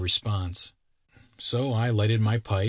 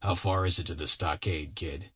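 low, subdued husky voice; 3 semitones lower, 4 dB quieter than usual. A narrator's reading. Bill on all fours asks the kid, husky and low. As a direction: ask it huskily, dropping away at the end. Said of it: "in a husky manner of voice".

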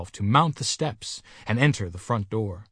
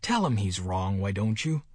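ordinary delivery, nothing marked

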